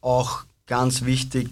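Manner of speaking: ordinary delivery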